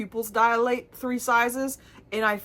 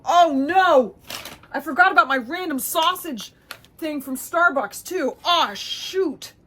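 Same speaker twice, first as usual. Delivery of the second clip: sudden dismay; 7 semitones higher, 5 dB louder than usual. Real, not acted. Remembering forgotten sausage.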